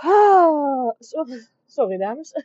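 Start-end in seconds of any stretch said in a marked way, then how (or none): 0.0-0.9 full real sneeze out loud. Real, not acted. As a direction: let a big sneeze out. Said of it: "oh, ik moet niezen".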